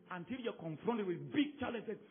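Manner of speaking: ordinary delivery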